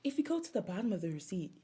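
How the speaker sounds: soft, quick mock-seductive pitch; hushed imitation of a sultry clerk. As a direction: murmur the offer close in the ear. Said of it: "like, sultry".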